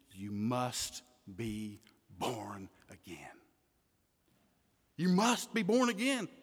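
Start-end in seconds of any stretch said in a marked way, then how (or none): 0.1-3.2 slow hushed whisper, much softer. Acted. Preacher quoting Jesus to Nicodemus, soft slow whisper. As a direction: breathe the words like a secret.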